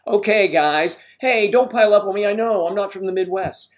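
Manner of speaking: loud, high plea to back off; mock-defensive protest. Real, not acted. Host to viewers piling up on him, loud protest. Defending himself to teasing chat.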